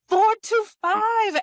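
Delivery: South African "four to five" yelled high and slow across the room; drawn-out shouted "to"; mock scream. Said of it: "screaming this across the room".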